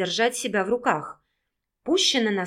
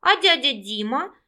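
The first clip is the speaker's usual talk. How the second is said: put-on lightly surprised little boy asking; a child's mild surprise, a bit higher; 4 semitones higher, 3 dB louder than usual. A narrator's reading. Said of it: "удивлённо спросил Егорка".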